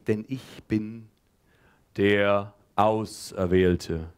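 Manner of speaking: low, very slow grand pronouncement; chosen one proclamation. Acted. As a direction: intone it like a messiah. Teacher proclaiming himself chosen.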